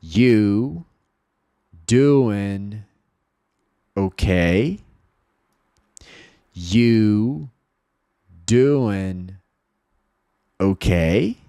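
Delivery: each word alone with long gaps, rising "ok"; separated teaching pronunciation, questioning last word; slow word-by-word model. Shown as a demonstration. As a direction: say it word by word for learners to repeat. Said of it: "Fala em voz alta comigo".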